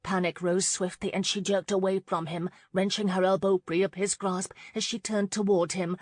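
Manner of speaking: ordinary delivery, nothing marked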